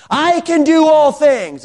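high, slow, mock-grand proclamation; hammed-up victorious preacher cry; triumphant declaration. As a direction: sing out the claim high and drawn out. Repeats the half-finished verse grandly.

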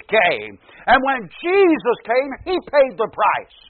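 high, loud, building sermon delivery; impassioned pulpit preaching; preaching at full force. Real, not acted. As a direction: drive the list up to a ringing climax.

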